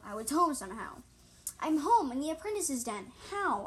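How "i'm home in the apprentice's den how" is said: raised, higher-pitched exclamation; narrated yell. A narrator's reading. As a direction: call it out higher and louder, short of a full yell. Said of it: "I yelled".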